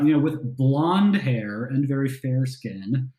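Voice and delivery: normal talking voice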